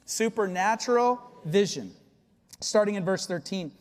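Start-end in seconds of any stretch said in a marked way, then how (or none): none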